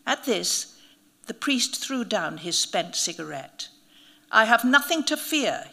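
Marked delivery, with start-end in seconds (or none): 4.3-5.7 louder bold declaration; announcing. A narrator's reading. Priest boasting he fears nothing, announcing loudly. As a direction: proclaim it to show no fear. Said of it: "he announced".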